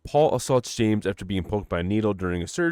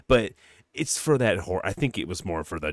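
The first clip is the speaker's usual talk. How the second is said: ordinary delivery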